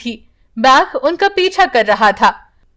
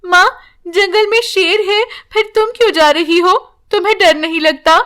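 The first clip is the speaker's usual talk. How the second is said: put-on higher, childlike worried voice; young daughter's voice; 7 semitones higher than usual. Daughter asks mother why she goes, childlike higher voice. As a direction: lightly play a little girl asking her mother.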